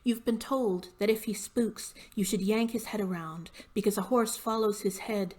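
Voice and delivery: firm, measured poetry recital; poem reading. Acted. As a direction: read the poem in deliberate, emphatic phrases. Reads own poem aloud.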